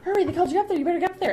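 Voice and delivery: high, pressing mock urgency; urgent prodding. Urging Patton to the stage.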